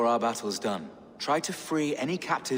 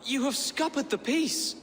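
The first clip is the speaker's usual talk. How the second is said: sharp rebuke; 13 semitones higher than usual. Acted. Monk confronts Ivar over the slaughter, sharp angry call. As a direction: call him out in shock. Priest rebukes warrior after killing.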